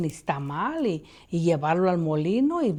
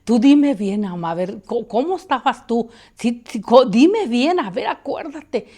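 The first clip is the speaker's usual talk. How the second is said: louder, higher pressing questions; insistent urging; 5 semitones higher, 8 dB louder than usual. Retold or imitated. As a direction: push mom to remember. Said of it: "Yo le decía". (insistencia, más fuerte y aguda)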